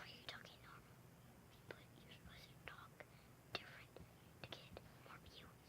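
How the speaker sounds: stage whisper, very soft; urgent whisper. Real, not acted. Reminding the friend to talk different for views, whisper. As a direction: whisper a secret prompt to your co-star. Whispered prompt to co-star mid-video.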